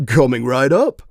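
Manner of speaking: bright eager screen greeter; cheery screen mascot. A narrator's reading. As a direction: chirp it like a cartoon dog. Animated dog screen answers order.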